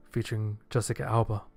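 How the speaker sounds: ordinary delivery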